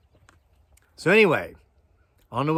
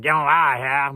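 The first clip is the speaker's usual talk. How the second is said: louder Appalachian Get on out of here, vowels clipped to yeh-on-a-here; Appalachian accent; 3 semitones higher, 8 dB louder than usual. Shown as a demonstration. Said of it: "'cause I'm from Appalachistan".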